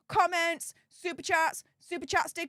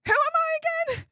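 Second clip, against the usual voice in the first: much higher and louder, with I punched; mock starstruck, bigger; 13 semitones higher, 5 dB louder, much faster than usual. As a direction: shout the joke question again, hammed-up. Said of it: "I'm like".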